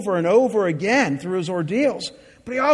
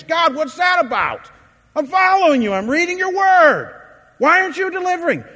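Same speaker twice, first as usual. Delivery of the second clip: much louder and higher, pleading protest; exasperated plea; 10 semitones higher, 6 dB louder than usual. Retold or imitated. Depressed believer demanding God deliver, loud and high.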